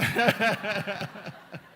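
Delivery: carcajada real y sonora; risa. Real, not acted. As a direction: suelta una risa larga por la anécdota. Se ríe tras la pregunta. (risa fuerte, espontánea)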